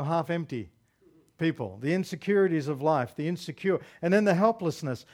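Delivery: ordinary delivery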